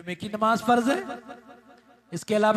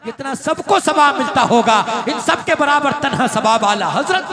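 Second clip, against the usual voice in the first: louder, higher exclaiming praise; loud proud sermon cry; preaching climax; 5 semitones higher, 10 dB louder than usual. Acted. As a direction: lift it to a triumphant peak.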